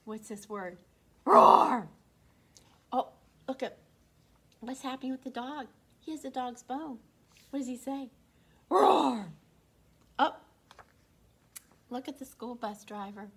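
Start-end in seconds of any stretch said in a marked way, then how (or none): 1.2-1.9 loud playful roar; dinosaur roar. Pretend. Children roar the word. 8.7-9.3 loud playful roar; dinosaur roar. Pretend. Dinosaur roars at the dog.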